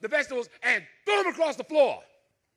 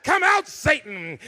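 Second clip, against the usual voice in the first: loud, slow exorcising preacher; preaching mother; 5 semitones higher, 7 dB louder, slower than usual. Retold or imitated. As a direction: cast Satan out, one word at a time. Mother casting out Satan.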